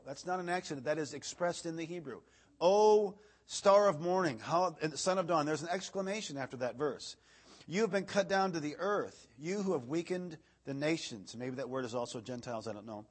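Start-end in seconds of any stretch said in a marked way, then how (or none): 2.6-5.4 raised, declaiming pulpit reading voice; scripture reading. Acted. Preacher reading Isaiah to the congregation, declaiming. 7.7-11.4 raised, declaiming pulpit reading voice; scripture reading. Acted. Preacher reading Isaiah to the congregation, declaiming.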